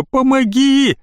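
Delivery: high desperate plea; high frightened cry for help. Acted. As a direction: call for help in panic. Boy begging for help.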